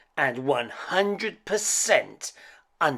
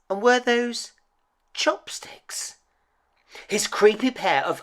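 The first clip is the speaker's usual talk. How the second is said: incredulous; 12 semitones higher, 5 dB louder than usual. A narrator's reading. Incredulous discovery.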